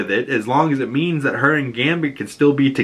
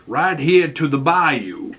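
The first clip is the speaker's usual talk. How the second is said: ordinary delivery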